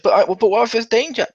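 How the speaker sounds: acted-out anxious objection; panicking. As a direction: imitate a worried crewmate, high-voiced. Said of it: "she's panicking".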